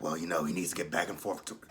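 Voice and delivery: low, soft, almost whispered naive woman; mock her as a soft-spoken, clueless woman; Angela voice. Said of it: "And Angela was like".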